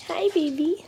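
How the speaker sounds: high cooing voice. Real, not acted. Greeting baby daughter.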